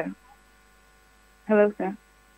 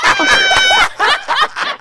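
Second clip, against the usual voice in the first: high, loud laughing scream of relief; burst of shrieking laughter; 4 dB louder than usual. Real, not acted. Radio host discovering the prank, shrieking with laughter. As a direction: shriek with laughter at being fooled.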